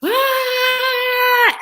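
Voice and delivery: high, sustained roller coaster scream; mock thrilled scream. Speaker imitates thrilled roller coaster riders, high mock scream. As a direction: let out a long, high-pitched "ah". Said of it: "just screaming their heads off".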